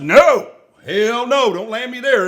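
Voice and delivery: loud mock refusal to the pilots; firm refusal. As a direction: put your foot down slowly.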